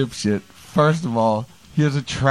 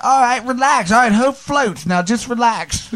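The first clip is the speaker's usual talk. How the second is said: much higher, whiny mock voice; imitating Novak; 11 semitones higher than usual. Friend on a plane mocked, much higher whine. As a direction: squeak it out like a flustered drunk. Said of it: "and he's just like".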